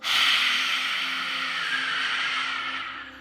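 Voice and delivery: long, airy exhale through the mouth; deep sigh. Shown as a demonstration. Guide demonstrates the exhale for meditators, long deep sigh. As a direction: let a slow breath stream out in a sigh. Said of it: "exhale for five seconds out of your mouth".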